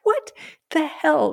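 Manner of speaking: high, stunned exclamation; retelling a jaw-dropping moment; shocked what the hell. Retold or imitated. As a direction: blurt it in disbelief, voice leaping up. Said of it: "I was like".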